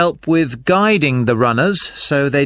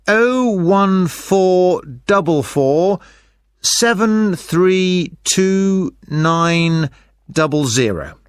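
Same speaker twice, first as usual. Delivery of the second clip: slow dictation; slower than usual. Acted. Dictating a phone number.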